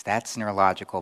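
usual speaking voice

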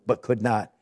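normal talking voice

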